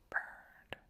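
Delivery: breathy whisper; whispered burned. Pretend. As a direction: breathe the word out. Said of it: "repeated word for effect".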